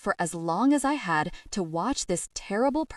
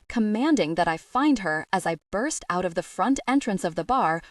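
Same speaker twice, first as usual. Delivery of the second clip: ordinary delivery, nothing marked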